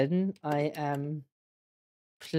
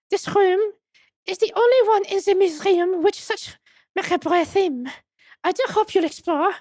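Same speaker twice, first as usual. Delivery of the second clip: put-on high, French-accented madam, politely welcoming; much higher voice with a French accent; French museum madam; 14 semitones higher, 3 dB louder than usual. Madam introducing the wax museum to visitors, high French accent.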